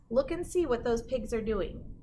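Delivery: ordinary delivery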